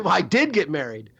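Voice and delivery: ordinary delivery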